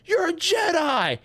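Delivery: exasperated shout; shouted disbelief. Retold or imitated. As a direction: yell it at the screen in disbelief. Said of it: "It's like".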